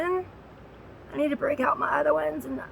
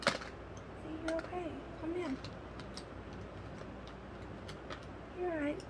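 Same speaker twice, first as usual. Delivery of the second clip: soothing the dog; 3 semitones higher, 12 dB quieter, faster than usual. Real, not acted. Owner hushing her scared, shaking dog with medicine promised, soft and soothing. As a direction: gently settle a trembling pet. Calming dog after thunder.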